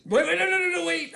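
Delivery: loud, high, rapid-fire protest; urgent interruption. Pretend. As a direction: burst in to stop everything, voice high and loud. Player urgently halts the scene.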